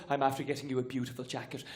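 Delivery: low, soft proud mammy; offering a gift in a low, soft voice; Irish mother voice. Retold or imitated. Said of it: "she goes".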